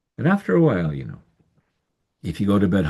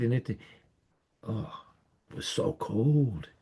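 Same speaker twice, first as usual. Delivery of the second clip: hushed, cold; 3 semitones higher, 8 dB quieter, slower than usual. A narrator's reading. Cold, voice drops away.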